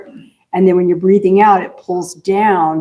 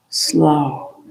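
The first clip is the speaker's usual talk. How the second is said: slow exhale demonstration; 5 semitones lower, 4 dB quieter than usual. Shown as a demonstration.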